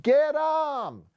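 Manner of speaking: high, shrill-pitched battle cry; chasing scream. Acted. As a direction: scream it to send the pack after them. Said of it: "Someone else screamed".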